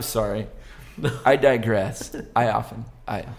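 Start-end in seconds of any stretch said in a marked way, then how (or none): none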